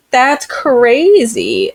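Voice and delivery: high, very drawn-out astonishment; slow mock-awed exclamation; mock amazement. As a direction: stretch it out high in hammed-up disbelief. Voices onlookers amazed by girl dragon.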